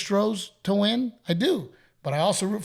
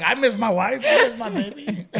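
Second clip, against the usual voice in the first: high, louder mock-lovesick wail; mock pining; 7 semitones higher, 4 dB louder than usual. Dad joking that he misses his family, mock pining. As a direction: pine for them through a laugh.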